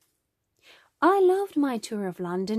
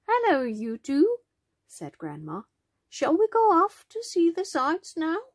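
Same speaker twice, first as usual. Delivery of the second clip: higher, lilting storybook grandma; chirpy grandma; 9 semitones higher, 4 dB louder than usual. A narrator's reading. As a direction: greet the children in a raised, sing-song grandma voice. Grandma voiced, waking and greeting children.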